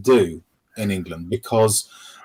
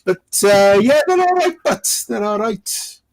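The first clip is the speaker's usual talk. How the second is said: high, drawn-out Welsh sing-song; Welsh accent; 10 semitones higher, 3 dB louder than usual. Acted. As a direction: exaggerate the Welsh lilt way up high. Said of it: "when I do the Welsh".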